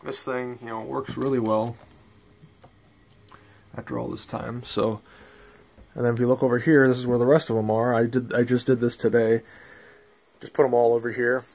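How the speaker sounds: ordinary talk, nothing marked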